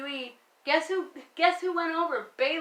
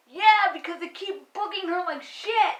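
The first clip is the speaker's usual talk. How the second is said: raised, higher-pitched angry retort; exasperated outburst; 5 semitones higher, 3 dB louder than usual. Real, not acted. Fan answers Bayley's critics about her booking, exasperated outburst. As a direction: fire back in real frustration.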